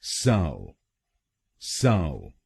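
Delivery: साव said alone, long aa, falling; first syllable alone. Shown as a demonstration. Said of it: "पहले शब्दांश को ... हम बोलेंगे".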